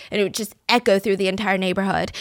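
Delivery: ordinary talk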